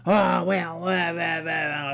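mock the old guy droning on, loud; mocking old man. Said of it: "this old guy going".

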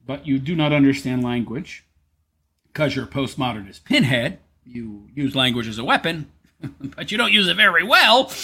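usual speaking voice

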